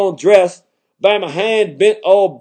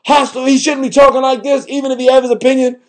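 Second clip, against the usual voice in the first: much higher, whiny mock-offended voice; mock offended listener; 6 semitones higher than usual. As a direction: imitate a prim listener clutching pearls. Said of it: "You like".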